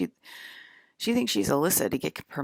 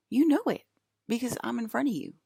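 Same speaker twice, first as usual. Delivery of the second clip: emphatic; 8 semitones higher than usual. Real, not acted. Insisting to viewers.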